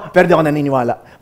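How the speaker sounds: mock quick take-back; acted contradiction. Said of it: "in the same sentence".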